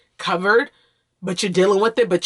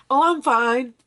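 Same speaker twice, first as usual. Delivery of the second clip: higher, breezy default answer; automatic fine; 7 semitones higher than usual. Retold or imitated. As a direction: toss it off like a reflex. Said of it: "And I instantly say".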